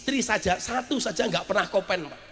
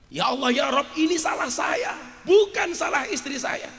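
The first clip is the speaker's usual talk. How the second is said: raised, pleading cry to God, acted out; anguished plea; 8 semitones higher than usual. Husband crying out to God over his wife, anguished plea. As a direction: plead upward, taking the blame. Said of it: "Saya nangis".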